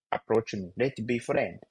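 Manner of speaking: ordinary delivery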